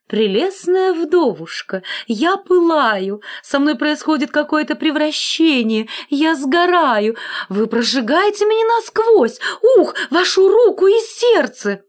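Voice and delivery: swooning lover catching fire; burning passion. A narrator's reading. Collar declaring love to the iron, rising to a cry.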